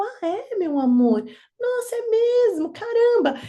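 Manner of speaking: put-on high-pitched indulgent wonder to a small child; acted motherly amazement. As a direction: play up surprise for a toddler. Mother answering child's discovery.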